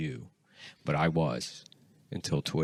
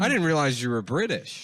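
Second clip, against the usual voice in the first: mock bank teller; 6 semitones higher, 4 dB louder than usual.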